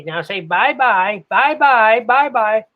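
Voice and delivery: high, loud coaxing of a pet bird; imitated wife. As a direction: mimic a wife prompting her crow to talk. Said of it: "she'd say".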